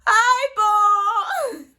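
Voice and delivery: high, loud mocking hello; mock cheeky greeting. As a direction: toss off a sassy fake-sweet greeting. Her cheeky reply to taxi driver.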